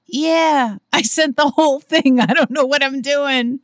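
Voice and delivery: loud, high, panicked admission; flustered yell. Retold or imitated. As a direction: blurt it out, helpless and loud. Retelling her own flustered reply.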